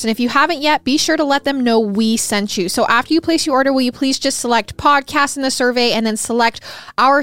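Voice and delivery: upbeat, loud sponsor read. Acted. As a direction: sell it like a hosted podcast ad.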